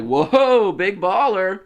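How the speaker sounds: imitated impressed woman. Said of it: "and she's like".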